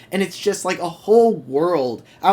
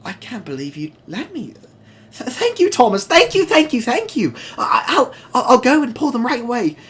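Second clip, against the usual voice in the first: much higher, voice cracking with gratitude; choked-up thanks spilling out; near tears; 9 semitones higher, 5 dB louder than usual. Acted. Mavis thanking Thomas, high and close to tears. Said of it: "almost in tears".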